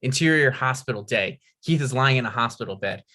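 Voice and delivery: normal talking voice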